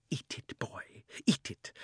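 tempting whisper. A narrator's reading. Witch urges temptingly.

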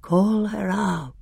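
cold and grave; grey voice. A narrator's reading. Grey voice urging the hag, hollow and slow. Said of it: "said the grey voice".

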